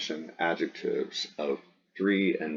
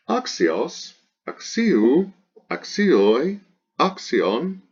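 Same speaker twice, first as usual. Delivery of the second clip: high singsong chant of word forms, each swinging up; paradigm recitation; 8 semitones higher, much slower than usual. Shown as a demonstration. Teacher reciting the declension, high singsong drill. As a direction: drill each form separately with an exaggerated pitch accent.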